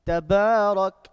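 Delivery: slow, drawn-out recitation, loud; Quran recitation. Shown as a demonstration. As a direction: recite it loudly with long held vowels. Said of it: "For example".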